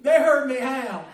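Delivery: normal talking voice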